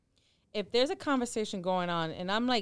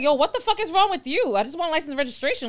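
loud, high pretend cop outburst; exasperated mock rebuke; 5 semitones higher, 6 dB louder than usual. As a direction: play the fed-up cop telling someone off. Acts exasperated cop rebuking driver.

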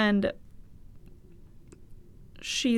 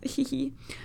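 soft whispered giggle; 4 semitones higher, 8 dB quieter than usual. Real, not acted. Laugh alone on a line.

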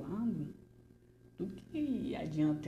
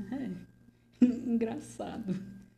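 laughing; 5 semitones higher, 4 dB louder, slower than usual. Acted. Bitter laugh.

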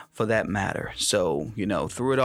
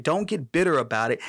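ordinary talk

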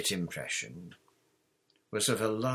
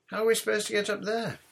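high, loud, quick bafflement; baffled question; 12 semitones higher, 5 dB louder, much faster than usual. A narrator's reading. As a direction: blurt out a puzzled question, pitched way up. Boy baffled by ceiling trapdoor.

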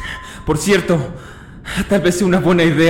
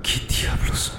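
susurro asustado; 8 dB quieter, much slower than usual. Acted. Susto en el batiscafo.

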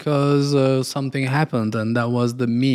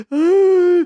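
mock cry; 5 dB louder than usual. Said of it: "They said".

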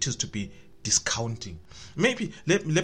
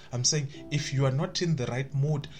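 ordinary talk

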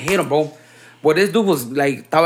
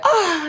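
a short yelp, pitched much higher, imitated; high-pitched cry; 13 semitones higher than usual. Telling a friend about the knocked-out guy's cry in a high squeal. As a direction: mimic a squeal as he goes down. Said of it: "he hit me with the high pitch that".

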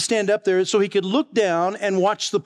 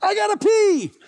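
hollered back. Retold or imitated. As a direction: holler it up like a desperate kid. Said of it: "And I hollered back".

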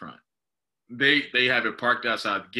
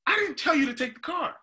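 much higher, incredulous rant; indignant disbelief; 11 semitones higher than usual. Retold or imitated. Retelling the tow clerk fight, pitched-up indignant disbelief. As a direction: replay the outrage at the clerk, voice pitched way up.

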